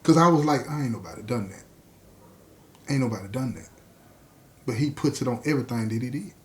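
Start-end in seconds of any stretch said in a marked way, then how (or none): 0.7-3.7 low, soft sceptical aside; disbelieving. Retold or imitated. Listener doubting money was left on porch, quietly sceptical. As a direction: say it low, as if privately unconvinced.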